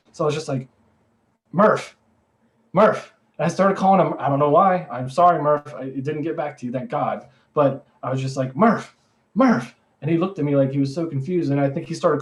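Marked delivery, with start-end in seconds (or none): 1.5-3.1 mock urgent call of a name, a little louder; short falling calls to get someone's attention; retold calling out. Calling a friend on the roof by code name, retold calling out. 8.6-9.8 two high, falling mock calls; retold calling out. Calling a confused friend on the roof, retold calling out.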